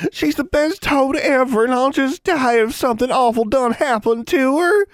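wailing. A narrator's reading. Young witch fretting over her lost toad, wailing. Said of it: "Innocence wailed".

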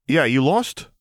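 put-on a cheeky girl's question; young girl asking. Girl questions the vampire in a high voice.

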